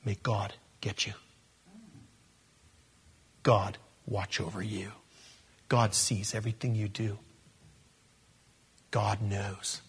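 soft, low, breathy threat; hushed ominous threat; menacing whisper. Retold or imitated. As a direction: warn him under your breath. Laban's veiled threat retold.

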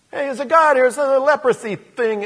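high, loud call across the house; servant calling out. Acted. Gehazi calling to Elisha about the visitor, loud. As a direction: shout it to the master inside.

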